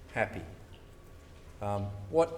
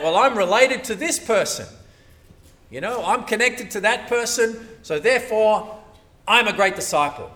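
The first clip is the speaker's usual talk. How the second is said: lightly voiced proud believer, louder; mock boast; 5 semitones higher, 7 dB louder than usual. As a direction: play a smug churchgoer bragging.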